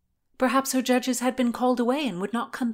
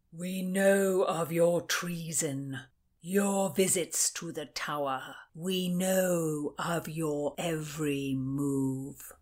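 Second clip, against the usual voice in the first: lower, slow, weighty lord; commanding Caesar voice; 4 semitones lower, slower than usual. A narrator's reading. Lord William Cecil confronts Constance with her treason, slow and weighty. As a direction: lay out his knowledge like a cold verdict. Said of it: "his voice like a Caesar".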